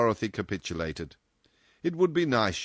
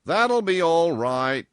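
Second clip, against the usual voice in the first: loud, slow, higher voice; assured boss; 9 semitones higher, 8 dB louder, slower than usual. A narrator's reading. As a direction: brush the objection aside, slowly and firmly. Boss brushes off her objection.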